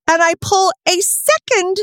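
slow, rising disbelief; building amazement. Real, not acted. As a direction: stretch it out as the shock dawns. Climax of the contact story.

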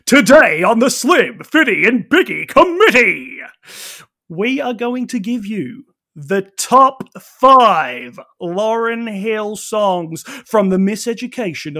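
booming showman's welcome, slowing down; grand entrance announcement. Pretend. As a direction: announce it like a stage host. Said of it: "can I announce the format?".

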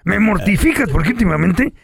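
put-on drunk voice. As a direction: sound like you left a cantina. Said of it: "Te oyes como salido de la pulquería". (voz de borracho de pulquería)

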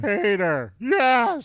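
loud, high holler rising to a yell; screamed in character. Acted. Host reacting to the cartoon name, screamed. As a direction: call the name, then belt the yes. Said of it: "be able to scream in his voice".

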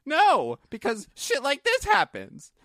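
loud, high-pitched indignant no; retold exasperated retort. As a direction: burst out in mock outrage.

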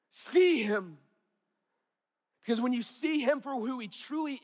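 high, loud impassioned exhortation; full-force preaching. Real, not acted. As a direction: preach at a near shout, stretching the key commands.

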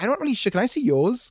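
high-pitched cheeky plea; mock coy request. Asking Pamela a cheeky favour, high mock-innocent voice.

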